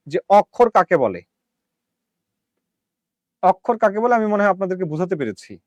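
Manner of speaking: usual speaking voice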